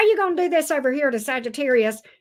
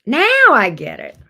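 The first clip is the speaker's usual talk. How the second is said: sudden realization; 7 dB louder than usual. Real, not acted.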